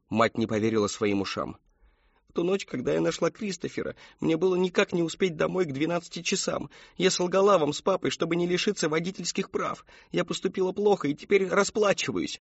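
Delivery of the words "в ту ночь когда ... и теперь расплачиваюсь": high-pitched, anguished confession; tearful confession. A narrator's reading. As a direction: confess through rising tears in a high voice. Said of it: "Мэри Кэтрин расплакалась".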